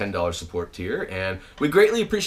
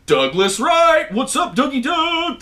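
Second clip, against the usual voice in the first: slow, much higher called greeting; shout-out; 11 semitones higher, 4 dB louder, slower than usual. Real, not acted. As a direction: hype up the buddy with a long call. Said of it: "shout all these out".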